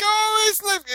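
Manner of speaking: very high cooing; mock baby talk. Describing adults cooing at the kids, very high baby talk. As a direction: coo like talking to a baby. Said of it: "We're like".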